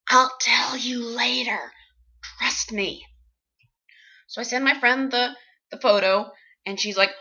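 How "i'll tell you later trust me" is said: hushed reply. Retold or imitated. Covert answer to whispering woman.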